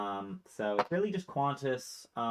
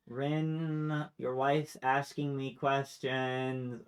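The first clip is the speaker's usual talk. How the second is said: slow, stretched-out whine; whiny complaint; 3 semitones higher, much slower than usual. Acted. Maya complaining to Rin about his wife, whiny. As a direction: drag each word out like a put-upon sibling.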